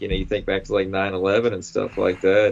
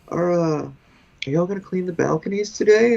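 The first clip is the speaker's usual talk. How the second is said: much higher, bright mock lady greeting; imitating the lady; 8 semitones higher than usual. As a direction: pitch the voice way up for the balcony lady. Voicing the lady again.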